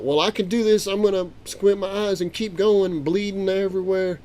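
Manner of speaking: excited retelling. Retold or imitated. Grandpa retells fight, acting himself.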